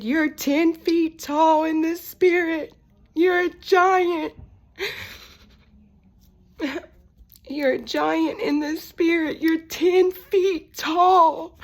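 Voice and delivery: high, slow, drawn-out yell. Retold or imitated. Father yelling to his daughter what he sees in her, loud. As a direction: cry it out at full voice, stretching the words. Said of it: "he started yelling".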